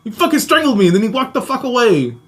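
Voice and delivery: high-pitched exasperated outburst; retold indignant protest. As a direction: act out an indignant younger self. Acting younger self protesting to brothers.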